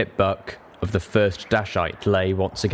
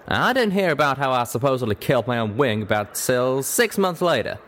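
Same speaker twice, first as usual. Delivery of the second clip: higher-pitched folksy Western character; drawling cowpony; 5 semitones higher than usual. Acted. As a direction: tell your story as a plain-spoken cowpony.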